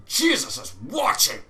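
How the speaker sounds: loud, pious shout to the gamer; mock church fight cry. Gamer shouting about fighting in a church, mock-preacher cry. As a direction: call out like a preacher mid-fight. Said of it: "You're like".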